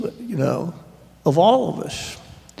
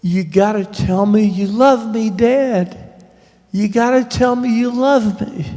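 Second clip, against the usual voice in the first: raised, pleading voice, higher; retold pleading cry; 6 semitones higher, 4 dB louder than usual. Sister begging dad to say he loves her, pleading and raised. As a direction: imitate sister begging her dying father. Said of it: "Carol is screaming this".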